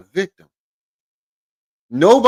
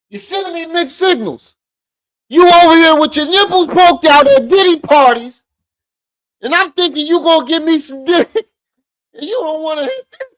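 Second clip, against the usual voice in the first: high, loud mock voice of an upset rapper; mocking imitation; 12 semitones higher, 8 dB louder than usual. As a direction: ham up his whiny, heated complaint. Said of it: "That's what I hear".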